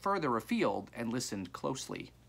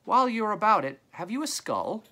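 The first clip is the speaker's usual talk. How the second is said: high-pitched lady calling out; woman's haggling call; lady's voice; 11 semitones higher, 6 dB louder than usual. A narrator's reading. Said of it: "came a lady's voice then".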